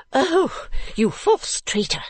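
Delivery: high, drawn-out accusing cry of an outraged lady; angry cry. A narrator's reading. Lady threatening violence on Mantalini, crying out angrily. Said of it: "cried the lady".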